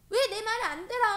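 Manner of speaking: high, loud acted outburst; angry yelling. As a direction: yell it like someone throwing a temper. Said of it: "승질내고 화내고 소리 지르고".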